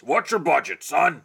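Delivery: gruff cowboy voice. Retold or imitated. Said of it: "gruff old kind of cowboy voice".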